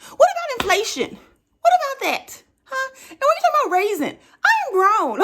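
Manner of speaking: fired-up comic retort with a laugh; indignant. Acted. Indignant comeback to dad.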